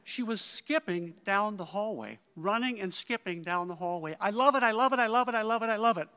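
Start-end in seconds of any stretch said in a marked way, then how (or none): 4.2-6.1 loud, high burst of delight; excited gushing. Retold or imitated. Colleague skipping down the hallway to her director, excited. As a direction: repeat it in a rush of joy, loud and high.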